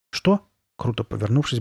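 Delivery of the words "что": short, higher-pitched startled question; baffled little boy's question; puzzled. A narrator's reading. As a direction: toss it out high, caught off guard. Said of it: "бросил Ноа. Он был явно озадачен".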